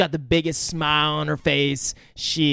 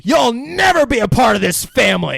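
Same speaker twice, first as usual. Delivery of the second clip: loud, higher threat with NEVER hit; mock angry shout; 6 semitones higher, 6 dB louder than usual. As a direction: yell it at him with contempt. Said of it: "gets real personal".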